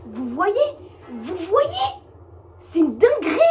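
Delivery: loud, rising excitement; excited shout. Real, not acted. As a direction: hype it up loudly. Excited while racing.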